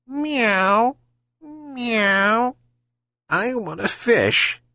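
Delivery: mewing cat. Acted. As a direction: mew like a wheedling cat. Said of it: "the cat jumped from the roof and mewed".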